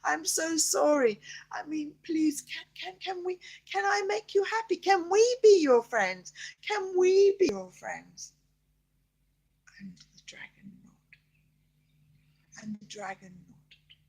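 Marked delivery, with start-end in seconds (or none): 0.0-8.3 put-on pleading child voice. Child pleads with crying dragon. 9.8-11.2 hushed, breathy storyteller whisper; whispered narration. A narrator's reading. Grandma telling bedtime story to child, whispering.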